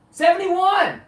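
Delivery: loud, high shouted number; shouted answer. Retold or imitated. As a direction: call it out proudly over the wind. Deaf friend shouting answer.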